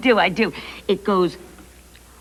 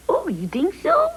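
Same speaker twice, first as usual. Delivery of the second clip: ordinary talk, nothing marked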